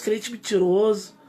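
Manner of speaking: normal talking voice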